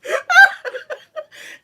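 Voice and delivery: loud cackle. Real, not acted. Big laugh at joke.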